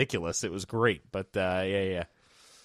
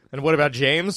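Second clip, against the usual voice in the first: ordinary delivery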